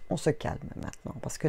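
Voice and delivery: hushed, fast whisper; whispered calm-down. Real, not acted. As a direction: breathe it out to settle down. Calming herself before the reading. (chuchoté, pour se calmer)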